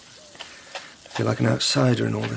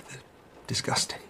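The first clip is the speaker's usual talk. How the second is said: whispered disgust; 10 dB quieter than usual. Acted.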